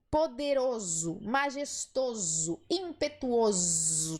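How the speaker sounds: slow, with a voiced z sound in "poderoso", "majestoso", "impetuoso"; Portuguese -oso. Shown as a demonstration. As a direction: stretch the -oso ending as a buzzing "ozo". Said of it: "Tá vendo esse som de z?". (terminação -oso com som de z, devagar)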